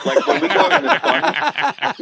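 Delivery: high, slow, giggling through the line; can't hold back the laugh while telling it; laughing while starting a joke; laughing through words. Real, not acted.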